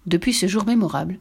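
plain reading voice

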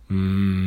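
long, low muttered hum; fox's pondering, closed-mouth grumble; grumbling hum. A narrator's reading. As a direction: grumble wordlessly, drawing it out. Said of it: "marmonna le renard".

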